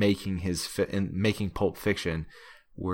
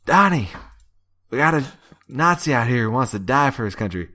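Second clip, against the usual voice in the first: put-on Brad Pitt's Appalachian accent; 2 semitones higher, 5 dB louder than usual. An officer orders his man to oblige the Nazi, in a loud, drawling Appalachian accent. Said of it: "he just leans into that accent".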